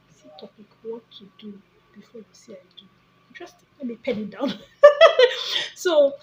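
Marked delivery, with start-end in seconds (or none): 0.1-3.7 musing to self. Real, not acted. Vlogger noting a topic idea for herself, musing quietly. 4.6-5.7 high, loud burst of laughter. Real, not acted. Amused after noting a video idea, laughing loudly.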